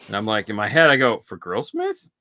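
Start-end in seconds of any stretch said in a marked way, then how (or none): 1.2-2.1 incredulous. Retold or imitated. Retold incredulous reply to host.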